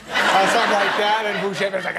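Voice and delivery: laughing. Real, not acted. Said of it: "laughing through line".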